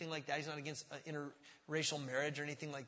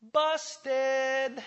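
playful; 8 semitones higher, 8 dB louder than usual. Real, not acted. Punchline after the reveal.

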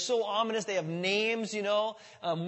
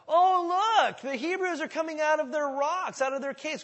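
high, sneering mock voice; 8 semitones higher, 4 dB louder than usual. Philistines mocking the Hebrews leaving caves, high sneer. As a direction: sneer at them from above. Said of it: "they're just mocking them".

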